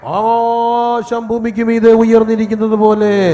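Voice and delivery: roaring, drawn-out pulpit climax; thunderous slow scripture cry; full-force preaching shout. Acted. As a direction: shout it long and sustained over the congregation.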